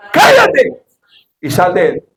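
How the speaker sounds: commanding yell; rebuking shout. Acted. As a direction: cast it out with a sudden cry. Jesus rebukes the demon.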